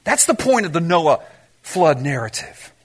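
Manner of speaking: ordinary talk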